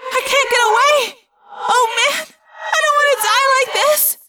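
very high, loud frantic pleading; panicked terror. Acted. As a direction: scream as if about to die. Cassie trapped, about to be crushed.

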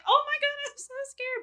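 high-pitched, hammed-up fright; playful scared squeal; mock panic. Voices panicked scribbling in the journal.

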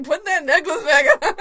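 loud, high yell breaking into laughter; laughing mock shout. Spoofing a cartoon line about the necklace, laughing shout. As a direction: shout it while losing it laughing.

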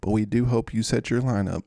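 usual speaking voice

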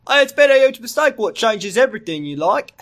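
high, broad parody Australian; mock Australian accent. Co-reader parodies the doctor's huge mistake, mock Australian accent. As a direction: blurt it in an over-the-top Australian accent.

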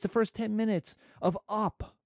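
ordinary talk

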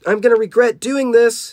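high, loud acted yell; high storybook scream. A narrator's reading. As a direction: scream it as the hero in trouble. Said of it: "he screamed".

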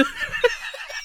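high burst of real laughter; can't stop laughing at the scene. Real, not acted. Podcaster cracking up over the nuclear wessels joke, high laughter.

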